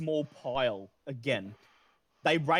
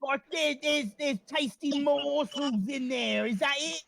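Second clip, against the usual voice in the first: a sly rat checking the plan, voice pitched way up; cheeky rat; 9 semitones higher than usual. Acted. Rat Radicus quizzing the party, high sly voice. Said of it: "He looks at you and he says".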